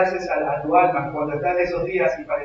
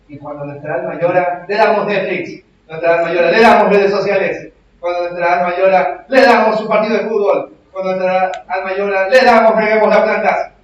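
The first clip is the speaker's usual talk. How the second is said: louder, higher fiery sermon build; preaching at full force; 4 semitones higher, 8 dB louder than usual. Acted. Pastor challenging the congregation, building fervent preaching. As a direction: drive the point home with rising fervor.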